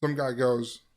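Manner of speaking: normal talking voice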